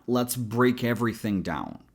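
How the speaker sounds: usual speaking voice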